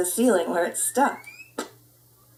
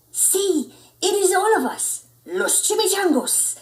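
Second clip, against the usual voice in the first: much higher, laughing tiny dog voice; tiny chihuahua; 10 semitones higher than usual. Acted. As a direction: cheerfully present the gang. Poquito Tito, high.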